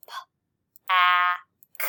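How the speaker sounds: "P, A, K" as bare sounds with pauses; phoneme by phoneme. Shown as a demonstration. Teacher counting phonemes, sound by sound. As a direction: sound out pack, short a in the middle. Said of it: "How many phonemes?".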